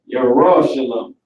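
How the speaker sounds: "Yahweh Shalom" as yah-RAW-sha-lum, weight on RAW; Hebrew pronunciation. Shown as a demonstration. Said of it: "That's how you say Jerusalem".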